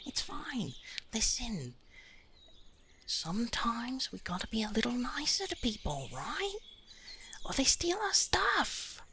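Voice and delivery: very high, soft whispered comfort; high soothing whisper. Acted. Chimney comforts his weeping lordship in a high whisper. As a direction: hush him like a crying child. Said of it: "He's like".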